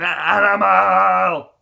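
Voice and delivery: slow, loud, growled Animal cry; Animal shout. Retold or imitated. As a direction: bellow the name like a wild drummer. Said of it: "Animal didn't have a whole lot of".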